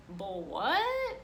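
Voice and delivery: baffled questioning voice; puzzled kid. Acted. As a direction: sound confused by the word.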